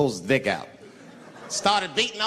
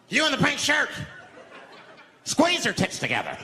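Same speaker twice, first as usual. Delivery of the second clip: making demands; 8 semitones higher than usual. Acted. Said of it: "he starts making demands".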